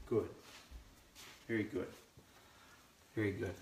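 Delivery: hushed, gentle praise to a puppy; soft praise. Real, not acted. Trainer quietly praising the puppy, hushed. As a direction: praise her softly so she stays settled.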